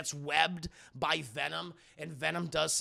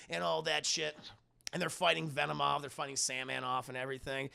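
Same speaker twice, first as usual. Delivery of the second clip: usual speaking voice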